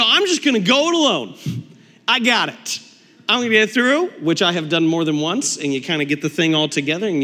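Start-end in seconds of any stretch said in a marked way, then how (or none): 0.0-4.1 higher, louder cocky resolve; brash can-do voice; mock bravado. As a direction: puff up and go it alone. Bravado building IKEA alone.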